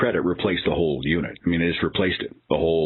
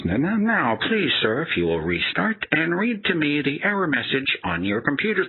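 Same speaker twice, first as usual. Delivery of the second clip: much higher, soothing singsong; condescending support agent; 8 semitones higher than usual. Retold or imitated. Mocking the agent calming the caller, higher and patronizing. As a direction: talk him down like a fussy child.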